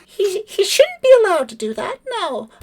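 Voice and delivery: very high, chirpy bird voice; high chirpy bird lady voice; pleasant bird lady. Acted. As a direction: chirp it like a bored bird receptionist. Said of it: "And she says".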